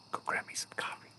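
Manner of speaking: whispered request for coffee; whispering. Real, not acted. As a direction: whisper it so nobody wakes. Quietly asks for coffee.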